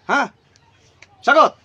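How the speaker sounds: sharp, loud, high shout; barked demand. Real, not acted. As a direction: demand an answer at once. Demands answer from the spirit.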